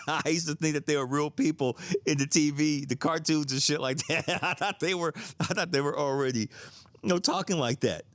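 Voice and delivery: giggling through the words, voice high; laughing while talking. Real, not acted. Telling the audience, laughing at how naive he was. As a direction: laugh it out at your younger self. Laughing at his own childhood belief.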